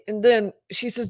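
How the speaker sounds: ordinary talk, nothing marked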